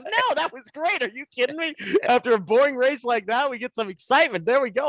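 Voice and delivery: high-pitched excited reassurance; Paul Arute, delighted. Retold or imitated. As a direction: act out a thrilled colleague's reply. Acting out Paul's delighted reply.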